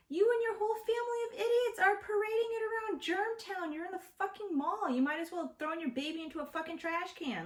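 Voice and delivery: high-pitched, exasperated rant at a careless parent; outraged scolding. Retold or imitated. Acted scolding of the mother.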